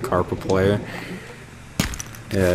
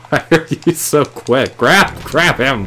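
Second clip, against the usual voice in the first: higher, louder, urgent; frantic yelling; 5 semitones higher, 4 dB louder than usual. Real, not acted. Gamer frantically trying to grab a fish, urgent higher shouts. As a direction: push hard to grab the fish in time.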